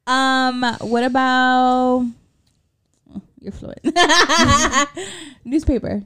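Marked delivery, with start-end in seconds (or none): 3.4-6.0 laughing through the words. Real, not acted. Laughing while quizzing.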